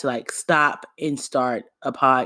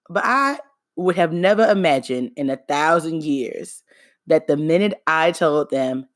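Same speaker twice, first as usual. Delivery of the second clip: ordinary talk, nothing marked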